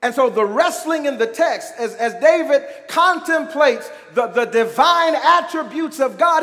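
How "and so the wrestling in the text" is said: ordinary delivery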